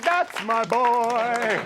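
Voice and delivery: loud, high, slow proud cheer; dad bellowing with pride from the stands. Retold or imitated. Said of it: "my dad was like".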